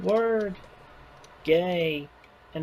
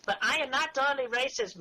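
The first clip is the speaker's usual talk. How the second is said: put-on voice; 8 semitones higher, 4 dB quieter than usual. Put-on voice again after accusation.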